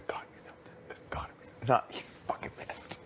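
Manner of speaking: imitating a secretive whisper; breath-only, hushed retelling; retold whispered suspicion. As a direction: whisper it like private worried gossip. Imitates someone privately suspecting he's mad.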